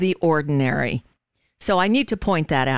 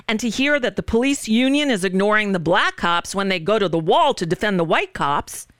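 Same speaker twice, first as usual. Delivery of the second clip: ordinary talk, nothing marked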